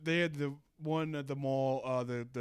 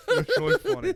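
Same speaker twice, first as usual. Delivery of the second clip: high, loud laughing squeal; 12 dB louder, faster than usual. Real, not acted. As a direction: squeal the words through laughter. Laughing about dad's prank.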